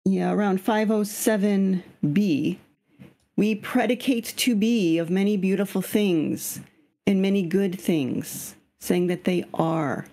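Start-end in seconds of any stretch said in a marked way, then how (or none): none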